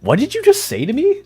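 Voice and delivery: high, shocked mock outrage; mock offended. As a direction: pretend to be deeply insulted. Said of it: "I acted so offended".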